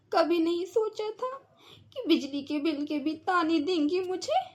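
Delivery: softly hurt, close to tears; a wounded, teary complaint; mother half-crying over the taunts about the bill; tearful. Acted. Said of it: "मेरी mummy रो रही है".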